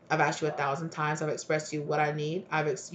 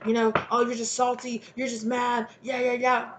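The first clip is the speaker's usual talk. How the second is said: mocking dismissal; 6 semitones higher than usual. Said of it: "it's like".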